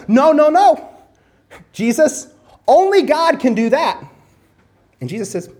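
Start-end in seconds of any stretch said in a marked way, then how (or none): 0.1-4.0 loud, high-pitched protest, acted out; indignant objection. Preacher voicing Pharisees rebuking Jesus, indignant and raised. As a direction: cut in firmly as an outraged religious leader.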